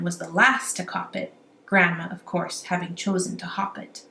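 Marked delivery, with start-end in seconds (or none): none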